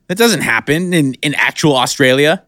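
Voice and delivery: normal talking voice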